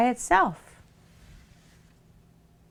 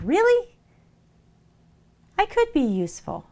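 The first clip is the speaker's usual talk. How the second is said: surprised pretty soap; 9 semitones higher than usual. Acted. Pretty soap hears it can be useful, squeaky surprise.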